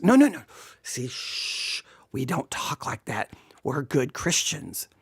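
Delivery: low, whispery shushing; mock hushed scolding. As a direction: hush them like a scandalised churchgoer. Mock hushing by prim believers.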